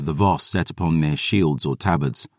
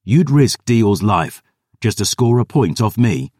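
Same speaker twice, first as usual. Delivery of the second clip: louder, higher furious accusation; angry accusation; 5 semitones higher, 4 dB louder than usual. Acted. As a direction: throw the charge at her. Gabriel furious.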